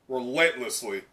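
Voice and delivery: normal talking voice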